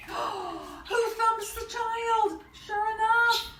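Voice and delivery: high, slow, drawn-out storytime line; high dramatic reading; excited reveal. A narrator's reading. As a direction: stretch out the big moment for children. Dramatic reveal in picture book.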